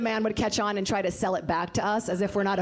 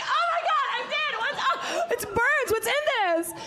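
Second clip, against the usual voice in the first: very high squealing panic; shrieking panic. Retold or imitated. Charlize panics on set after getting it in her eye, very high. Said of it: "She's like".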